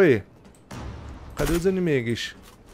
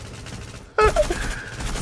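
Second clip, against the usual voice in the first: high breathy laugh; 5 dB quieter than usual. Real, not acted. Laugh at kill.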